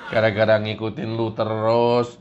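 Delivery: low, sulky complaining voice; low, sulky complaint to the devil; low grumbling Kurnia. Acted.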